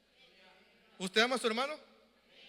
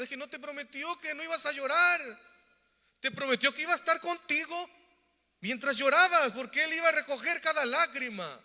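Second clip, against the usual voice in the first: higher, louder, impassioned; fervent preaching; 5 semitones higher, 5 dB louder than usual. Acted. Pastor comforting those who cry, fervent preaching. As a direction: lift the voice with conviction.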